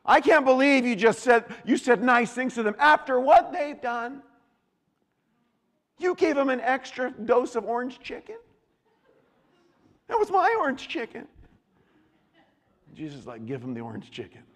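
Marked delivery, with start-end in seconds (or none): 0.1-11.3 high, loud, incredulous complaint; outraged protest. Acted. Preacher voicing someone indignant over extra orange chicken, outraged. 13.4-14.4 calm reply. Acted. Jesus answers the outraged complainer.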